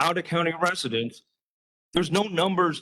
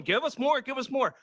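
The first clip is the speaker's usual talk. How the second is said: much higher, mock-whiny begging; mocking greedy officials; 8 semitones higher than usual. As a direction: mimic them asking for more, sneering. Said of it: "you're coming back going".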